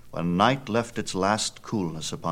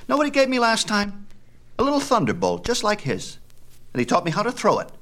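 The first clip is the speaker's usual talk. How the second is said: put-on high, quick bragging boy's voice; a show-off kid bragging about his dad; higher boy voice, boasting; boastful boy Epaphus; 10 semitones higher than usual. A narrator's reading.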